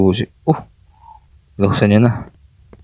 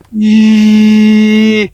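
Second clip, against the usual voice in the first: startled shriek; 9 semitones higher, 5 dB louder than usual. Real, not acted. Scared by monster appearing.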